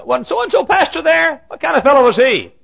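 high, loud, pointed query; pry at full volume; loud prying question. Retold or imitated. Visiting preacher asking about the former pastor, loud prying.

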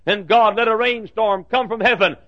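normal talking voice

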